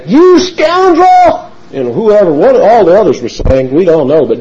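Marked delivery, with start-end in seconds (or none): 0.1-1.5 high, very slow mock cry of scorn; Shimei's insult. Preacher voicing Shimei cursing the fleeing king, mock outrage. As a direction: hurl the insult high and drawn out. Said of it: "Shimei saying".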